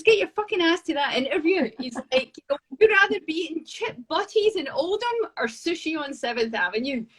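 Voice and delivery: pushing her toward the New York interview; retold pushy boss. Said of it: "He says".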